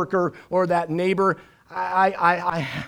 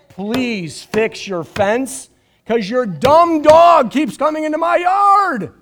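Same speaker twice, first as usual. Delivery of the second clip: louder and rising high, exasperated; mock rant at neighbor; 6 semitones higher, 8 dB louder, slower than usual. Pastor joking about the neighbor's dog, mock exasperated outburst. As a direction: let the complaint boil over into a comic outburst.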